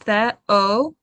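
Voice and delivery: two syllables, clipped and separate; staccato. Shown as a demonstration. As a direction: split it into single beats. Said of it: "syllable by syllable".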